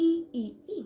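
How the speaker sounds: ই as three separate 'ee' sounds; model pronunciation. Shown as a demonstration. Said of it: "আই এর উচ্চারণটা তাহলে হবে". (আলাদা করে স্পষ্ট ই, ই, ই)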